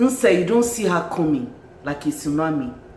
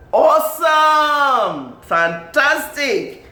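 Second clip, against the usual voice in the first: loud, high, stretched-out words; big slow celebratory shout; gushing praise; 9 semitones higher, 5 dB louder, much slower than usual. Real, not acted. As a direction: draw out each word in delight.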